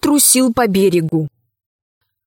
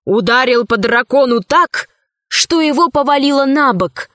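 plain reading voice

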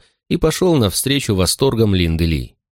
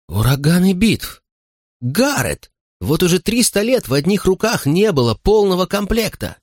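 high, thrilled exclamation; excited; 11 semitones higher than usual. Acted. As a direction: gasp it out in awe. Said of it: "схватила".

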